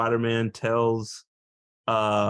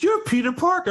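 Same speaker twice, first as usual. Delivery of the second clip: high-pitched mock amazement; little girl. Retelling a little fan learning the secret, high girlish surprise. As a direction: pitch it way up as an astonished kid.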